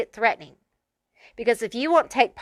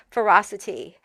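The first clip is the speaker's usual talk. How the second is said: ordinary talk, nothing marked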